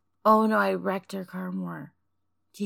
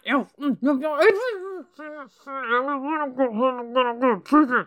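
whimpering. Acted. Cat fears prison, whimpers.

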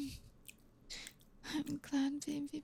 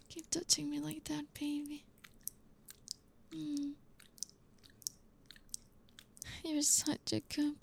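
whispered sweet nothings; 5 dB quieter than usual. Acted. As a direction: murmur tender words to a lover.

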